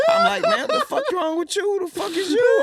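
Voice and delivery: high laughing yell while retelling. Real, not acted. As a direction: crack up while shouting the retort.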